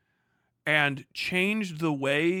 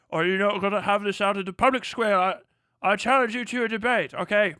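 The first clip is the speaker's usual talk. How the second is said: loud, higher-pitched theatrical challenge; mock challenger; 5 semitones higher, 8 dB louder than usual. Challenging someone to debate in public square, mock duellist. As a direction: proclaim it like a hammed-up duellist.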